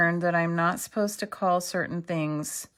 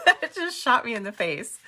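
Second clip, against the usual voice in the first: laughing surprise; 6 semitones higher than usual. Real, not acted. Crafter telling viewers the scrap hit her face, laughing. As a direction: still laughing, pitched up.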